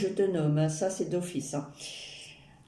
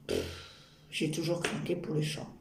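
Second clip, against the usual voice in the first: usual speaking voice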